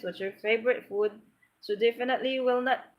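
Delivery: normal talking voice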